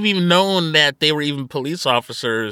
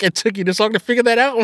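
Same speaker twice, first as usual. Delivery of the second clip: sarcastic; 6 semitones higher, faster than usual. Retold or imitated. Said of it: "her level of sarcasm is so refreshing".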